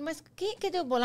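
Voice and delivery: mock-surprised wife demanding where the cake went; mock surprise. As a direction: act out the wife finding the cake gone. Wife confronting husband about cake.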